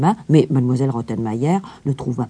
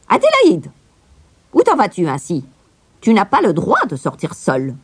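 high, louder call of the governess; stern governess; 5 semitones higher, 3 dB louder than usual. A narrator's reading. Governess catching Heidi on the landing, stern. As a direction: stop the runaway child. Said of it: "la gouvernante apparut".

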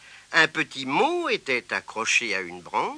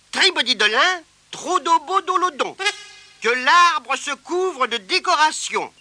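high, loud incantation; magic spell; 11 semitones higher, 4 dB louder than usual. Acted. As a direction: chant the spell grandly. Spider casts a spell.

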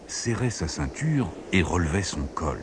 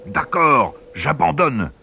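sneering; 8 semitones higher, 5 dB louder, slower than usual. A narrator's reading. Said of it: "ricana le vent".

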